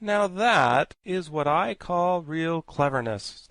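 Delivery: slow, approving praise. A narrator's reading. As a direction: savour the praise slowly. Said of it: "said the Scarecrow approvingly".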